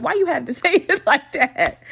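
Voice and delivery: complaint breaking into a laugh midway; amused complaint. Real, not acted. Podcaster objecting to his wording, laughing complaint. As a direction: start protesting, then crack up.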